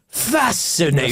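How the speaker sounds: slow, drawn-out posh delight; posh approval. Retold or imitated. Posh person who likes you reacts, slow approving voice. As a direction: savour it like a posh admirer. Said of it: "when you know they like you".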